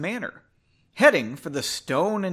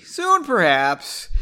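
high, loud, drawn-out reply; slow, playful overplayed evasion; exaggerated noncommittal; 6 semitones higher, 6 dB louder, much slower than usual. A narrator's reading. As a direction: stretch it out in a hammed-up sing-song. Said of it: "she said not".

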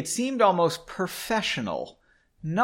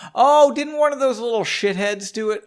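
high, loud burst of recall; excited outburst; 8 semitones higher, 7 dB louder than usual. Acted. Reader reacts as excited character.

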